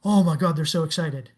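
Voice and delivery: loud, mock-excited report; gushing retold excitement, starting loud; excited evangelist. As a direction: imitate an evangelist bursting out with good news. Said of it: "he'll come out and go".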